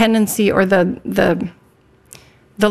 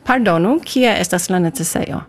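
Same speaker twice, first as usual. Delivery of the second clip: "Pardonu, kie estas la necesejo" run together fluently; quick, fluent. Shown as a demonstration. Guest showing host "Pardonu, kie estas la necesejo", quick fluent delivery. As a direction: say it properly in one quick go, no gaps between words. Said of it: "That was very quick".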